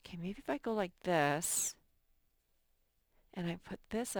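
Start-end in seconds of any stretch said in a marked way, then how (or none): none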